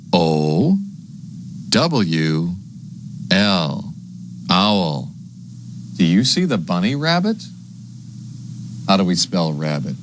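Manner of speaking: usual speaking voice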